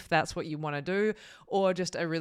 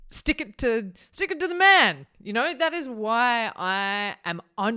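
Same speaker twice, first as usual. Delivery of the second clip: defiant shout; 6 semitones higher, 8 dB louder than usual. Real, not acted. Defiant YouTube motivation.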